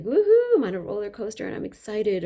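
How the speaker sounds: high, loud roller-coaster cheer; excited whoop. Shown as a demonstration. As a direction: whoop like you're on a ride. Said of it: "call and response of just like".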